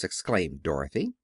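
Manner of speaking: ordinary delivery, nothing marked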